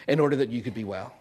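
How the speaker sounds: usual speaking voice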